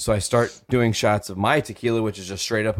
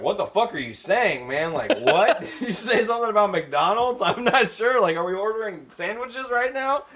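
much higher, confused caller; baffled customer; 12 semitones higher than usual. Retold or imitated. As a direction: squeak out total confusion. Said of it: "people are like".

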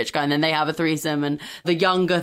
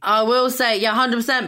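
ordinary talk, nothing marked

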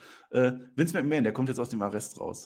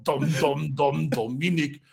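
Dominik voice; 3 semitones higher, 4 dB louder, much slower than usual. Acted. Narrator doing his Darth Dom voice, slow and ominous. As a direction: drag the name out like an ominous villain. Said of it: "diese Dominik-Stimme".

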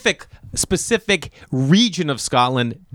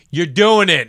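raised, higher command; stern principal; 6 semitones higher, 6 dB louder than usual. Retold or imitated. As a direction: lay down the law as the principal. Principal's order, retold.

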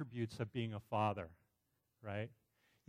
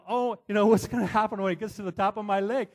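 mock panic; 8 semitones higher, 11 dB louder than usual. Recalled panicked thought, trapped leg.

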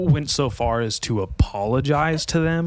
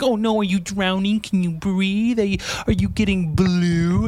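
higher, mock-worried sing-song; fake concern; 8 semitones higher than usual. Faking concern for the choking neighbor, high and mocking. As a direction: pretend to care, sarcastically. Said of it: "run up and be like".

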